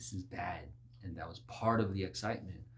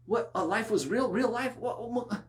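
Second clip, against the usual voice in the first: mock shock; 11 semitones higher, 5 dB louder than usual. Mock shocked sinner at judgment.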